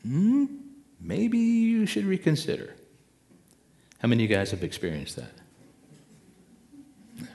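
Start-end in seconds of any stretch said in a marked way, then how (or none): 0.0-2.8 high, louder little voice of doubt; little inner voice. Acted. Inner voice cautioning someone about to act, high little voice. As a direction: pipe up as a tiny nagging conscience. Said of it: "that little voice goes".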